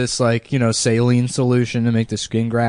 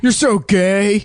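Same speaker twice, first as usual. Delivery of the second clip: put-on jeering kid; 7 semitones higher, slower than usual. Acted twelve-year-old kids jeering.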